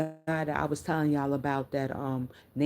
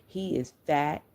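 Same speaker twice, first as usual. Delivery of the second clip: normal talking voice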